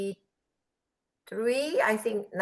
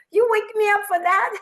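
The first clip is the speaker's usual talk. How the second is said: high-pitched imitation of disbelief; mock incredulous protest; 13 semitones higher, 4 dB louder than usual. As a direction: squawk it like someone woken for nothing. Imitating woken husband's protest.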